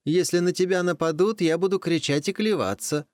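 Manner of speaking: high, urgent rooster voice; pleading rooster. Acted. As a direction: beg at the door like a rooster. Rooster begs to come in.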